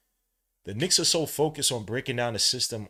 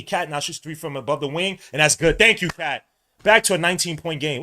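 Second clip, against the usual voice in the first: raised, louder play-by-play; excited basket call; 5 semitones higher, 8 dB louder than usual. Real, not acted. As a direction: build to a loud call as it drops. Calling a made three-pointer.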